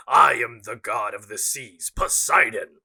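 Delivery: slow, loud, grand proclamation; booming god voice. Shown as a demonstration. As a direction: announce yourself like a mighty sea god. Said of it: "Say it like you're a god".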